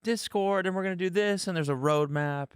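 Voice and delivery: high, mocking voice of her promises; mocking quote. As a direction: parody her sales pitch. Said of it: "it's like".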